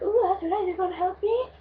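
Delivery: high-pitched delighted reenactment; excited, happy. Retold or imitated. Stranded girl to helpful trucker, excited and happy. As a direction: act out giddy relief, pitched well up. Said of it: "So excited, so happy".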